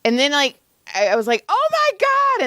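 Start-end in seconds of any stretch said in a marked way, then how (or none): 1.5-2.4 high-pitched, acted-out cry of shock; retold scream. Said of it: "then I started screaming at him".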